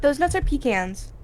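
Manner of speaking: pecans said as PEE-cans; slow correction, pecans as PEE-cans; PEE-can pronunciation. Real, not acted. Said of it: "You pronounce it that way just to spite me".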